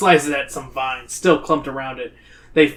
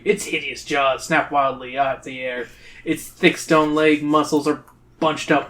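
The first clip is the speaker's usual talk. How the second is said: ordinary delivery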